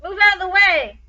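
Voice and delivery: high, loud order to the crowd; commanding shout. A narrator's reading. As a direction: clear the crowd with a bellow. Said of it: "he shouted".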